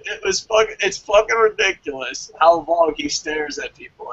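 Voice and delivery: ordinary delivery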